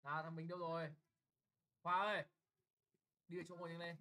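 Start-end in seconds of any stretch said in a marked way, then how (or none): 1.8-2.2 loud, low call to a pupil. Real, not acted. Teacher summoning a pupil to the lesson, calling out. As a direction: call the pupil sharply to attention.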